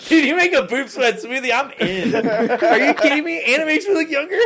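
high, giggling through the words; laughing. Real, not acted. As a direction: laugh while you talk. Laughing at the boob sweat smoothie.

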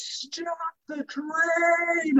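high, slow, stretched-out cry of stop the train; mock screech. A frantic tourist yelling at the rail worker, high and drawn out. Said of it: "She goes".

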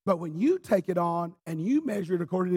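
ordinary talk, nothing marked

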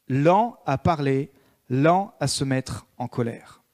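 slow, weighed reading of the verse; drawn-out preacher's emphasis; slow emphatic scripture. Acted. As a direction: let each lent sink in slowly.

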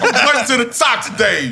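loud, high, playful boasting; hyped-up bragging. Pretend. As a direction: crow it like a hype man, laughing.

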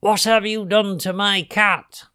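high, accusing witch's cry; upset witch calling to the culprits. A narrator's reading. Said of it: "she called out to the children".

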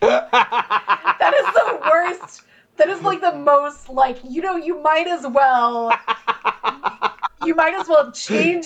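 laughing through the words; giggling teasing. Real, not acted. Laughing while teasing friend.

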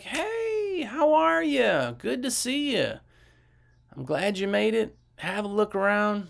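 very high, drawn-out hello; slow singsong welcome, high-pitched; slow, easygoing greeting. Acted. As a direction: stretch the greeting out slowly in a put-on high voice. Models a slow-talking customer to mirror.

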